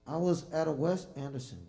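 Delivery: booming into the mic before adjusting it; way too hot on the mic, loud and high. Real, not acted. Musician starts the story too close to the mic, loud. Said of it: "pull this slightly down".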